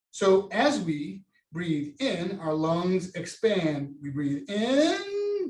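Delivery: drawn-out, slow demo of breathing in; slow breathing cue. Shown as a demonstration. Teacher leading class through a breath, slowly. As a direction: stretch it out so they follow. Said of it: "do it with me".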